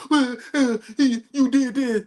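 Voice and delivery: high, put-on whiny voice; mock whining. Mocking gang families who cry too late, high whiny accusing voice. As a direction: whimper it like a family member blaming him too late. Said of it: "They wanna cry later".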